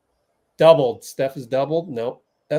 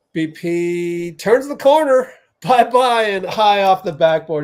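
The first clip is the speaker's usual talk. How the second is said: excited play-by-play; 5 semitones higher, 5 dB louder than usual. Real, not acted.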